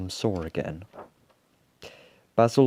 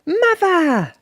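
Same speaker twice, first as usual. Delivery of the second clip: put-on very high, loud child creature's cry; shrill little call from the crowd; 10 dB louder than usual. A narrator's reading. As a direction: cry out for mother. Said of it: "a voice called out from the crowd".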